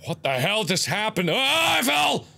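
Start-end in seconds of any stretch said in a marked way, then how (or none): 1.3-2.2 high shout of alarm; startled yell. Real, not acted. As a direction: cry out as the floor drops away. Falls suddenly in the game.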